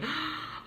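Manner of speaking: high, sharp acted gasp; sudden stunned intake; shocked gasp. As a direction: gasp in mock horror. Shocked reaction, retold.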